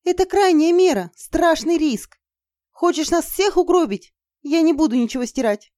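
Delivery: high, loud, agitated refusal; alarmed protest. Acted. Izergil refusing to erase runes, alarmed. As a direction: protest the terrible risk.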